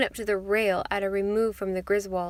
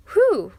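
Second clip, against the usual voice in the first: high, loud whew of amazement; whistled whew; 10 semitones higher, 9 dB louder than usual. A narrator's reading. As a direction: let out a high, falling whew. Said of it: "whistled Mister Griswold".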